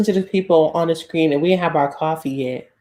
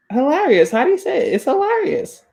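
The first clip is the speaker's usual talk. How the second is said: climbing much higher on each try; self-conscious pronunciation check; 9 semitones higher than usual. Shown as a demonstration. Host asking friends how to say hilarious, pitched ever higher. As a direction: test HILARIOUS, voice rising into a high squeak. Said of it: "Wait, how did I say it?".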